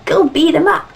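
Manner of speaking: eager order to attack; called-out command. Acted. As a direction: call it out like sending troops in. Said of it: "and you go".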